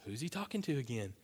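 ordinary talk

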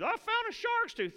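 put-on squealing, very high and loud kid's cry; excited child. Kid running up the beach with a shark's tooth, squealing with excitement. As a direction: play the thrilled kid showing off a find.

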